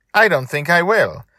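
put-on high, loud child's shout. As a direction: shout the signal. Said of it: "she shouted".

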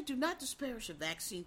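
soft, measured mock-sober mimicry; calm preacher voice. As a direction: imitate a calm, rational-sounding politician. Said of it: "You're just talking like this".

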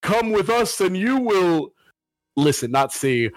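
slow, high, eerie horror host voice. Acted. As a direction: draw it out like a haunted TV host. Spooky podcast intro.

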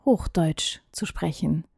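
slow hushed whisper; slowly whispered, almost to yourself. Acted. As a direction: let the question fade into a whisper. Rhetorical question to viewers.

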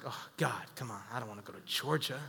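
low, very soft mock complaint; reluctant grumble. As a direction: grumble under your breath to God. Retells his reluctant reply to God.

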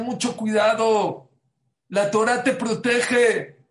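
loud, slow preaching from the pulpit. Acted. Rabbi warning the congregation, loud and slow.

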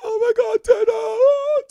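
high, loud sobbing wail; hammed-up wail of a crying moviegoer; mock wailing cry. Retelling a sobbing woman in the theater, hammed-up wail. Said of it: "a woman that was just".

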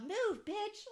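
high, loud, clear little outburst; mock toddler yell. Retelling son's outburst in the car, mock loud yell. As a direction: imitate a toddler belting it out. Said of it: "literally so loud".

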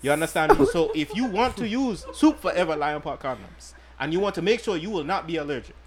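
mock African accent. Podcast host selling condoms in a light mock African accent. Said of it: "I must give you my best African accent".

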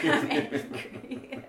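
slow, breathy mock-angry whisper through a laugh. Answering her co-host with a laughing mock anger. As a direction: say it as a joke, laughing.

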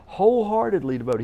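"Wholeheartedly" pitched high and loud; stress on Wholeheartedly. Real, not acted. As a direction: punch "Wholeheartedly" high, then let "devoted" fall. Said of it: "Listen to it again".

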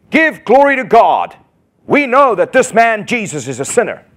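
a preached Pharisee proclaiming; stern Pharisees. Retold or imitated. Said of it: "and said to him".

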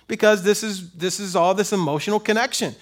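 usual speaking voice

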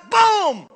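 loud sudden shouted boom; cannon boom. Acted. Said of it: "do the boom sounds".

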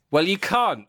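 high, snappy joke punchline; Aggressive Piglet. Acted. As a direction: retort like a cheeky little bully. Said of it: "An aggressive piglet replies".

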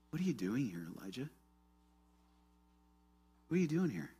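soft, tender question; gentle tone. Acted. God asking Elijah in the cave, gentle tone. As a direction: ask it gently, letting it fall away. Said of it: "right here in this gentle tone".

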